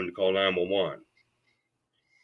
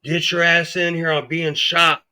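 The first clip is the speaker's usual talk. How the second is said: higher, louder call for help across the shop; retold yell; 7 semitones higher, 4 dB louder than usual. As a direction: shout his name over the noise. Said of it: "I started yelling for Brian".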